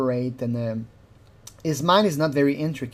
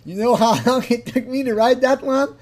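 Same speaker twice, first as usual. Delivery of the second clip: quoting the line while cracking up, pitched higher; laughing mimicry; 7 semitones higher than usual. Repeating the guy's line and breaking into laughter. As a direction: laugh through the mocking quote. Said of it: "that's the funniest line ever".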